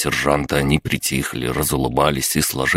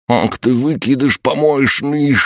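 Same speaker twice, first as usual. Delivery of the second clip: high-pitched, drawn-out angry yell; furious yell; 11 semitones higher, 4 dB louder than usual. A narrator's reading. Sidorovets abuses the man who knocked him down, furious yell. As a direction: yell the insult in a high, strained voice. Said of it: "проорал Сидоровец".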